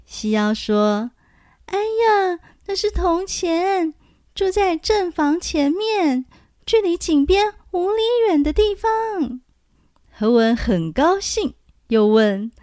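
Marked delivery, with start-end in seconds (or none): 1.7-9.4 thin high little voice. A narrator's reading. Xiyao voiced by narrator.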